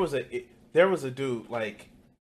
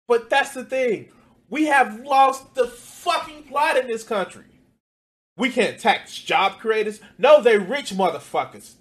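higher, louder, fired-up rant; heated rant; 8 semitones higher, 5 dB louder than usual. Real, not acted. Ranting to viewers about taxing the rich, heated and loud. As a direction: let the anger at the rich boil over.

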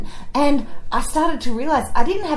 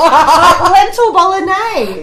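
laughing scorn; 8 semitones higher, 6 dB louder, much slower than usual. Real, not acted.

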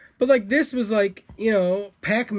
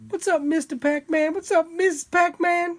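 higher put-on greeting voice; silly character voice; 9 semitones higher than usual. Host greeting Pac-Man in a silly higher character voice. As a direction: greet him in a goofy high voice. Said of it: "Why is that the voice they talk like?".

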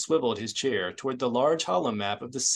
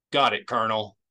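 brisk report; 4 dB louder, faster than usual. A narrator's reading. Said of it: "reported briskly".

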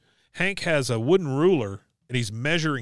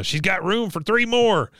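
mock call, higher pitched; 7 semitones higher than usual. Cartoon character announcing the truck has room, calling out.

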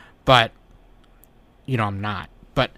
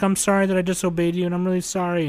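higher, rushed teary apology; a light hint of a crying child, pitched up; tearful little girl; 6 semitones higher, faster than usual. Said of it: "and she was crying".

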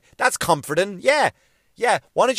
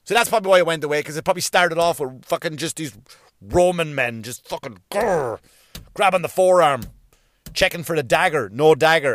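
ordinary delivery

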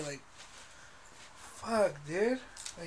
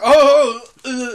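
flustered Biden; 7 semitones higher, 22 dB louder, slower than usual. Retold or imitated. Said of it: "he was like".

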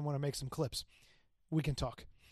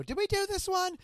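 high-pitched, puzzled self-quote; imagined confused self; 3 dB louder than usual. Pretend. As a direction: play your forgetful future self, pitched way up. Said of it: "I don't come back and go".

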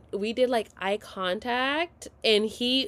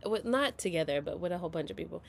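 ordinary delivery

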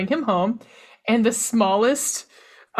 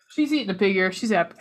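ordinary talk, nothing marked

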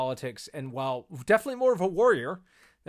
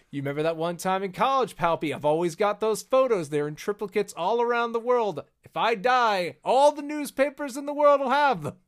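higher, louder, hammed-up mock threat; blackmailing Masamune; 6 semitones higher, 5 dB louder than usual. Masamune holding old college photos over Palpatine, mock blackmail. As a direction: play a cocky blackmailer needling an old friend. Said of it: "He's like".

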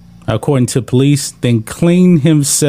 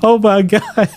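laughing; 7 semitones higher than usual. Real, not acted. Reacting through laughter.

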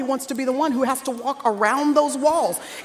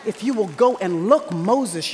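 ordinary delivery

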